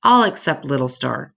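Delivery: ordinary delivery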